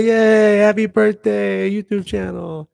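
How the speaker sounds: high, loud and drawn out celebration; party cheer. Real, not acted. Vlogger celebrating his YouTube channel, excited. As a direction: cheer like the balloons should drop.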